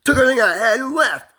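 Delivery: on the verge of sobbing; real distress. Real, not acted. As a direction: cry it out, pitch climbing. Upset about wife leaving him.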